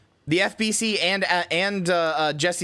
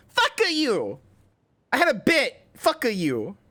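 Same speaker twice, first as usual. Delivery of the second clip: squeaky high voice; 9 semitones higher, slower than usual. Real, not acted. Playful high-pitched retort.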